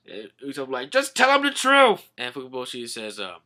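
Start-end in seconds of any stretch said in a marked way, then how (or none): 0.9-2.1 high, loud plea; hammed-up insistent holler; urgent yell. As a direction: shout it in mock desperation. Acted Usopp urges Zoro.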